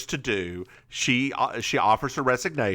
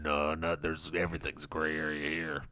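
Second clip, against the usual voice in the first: very low, soft monotone mumble; Joe; 10 semitones lower, 9 dB quieter than usual. Retold or imitated. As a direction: brush it off in a low drone. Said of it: "And he's like".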